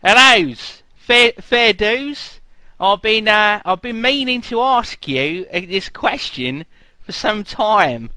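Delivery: hammed-up Australian accent; bad Australian accent. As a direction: ham up a terrible Australian accent. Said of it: "I don't know what part of Australian that is".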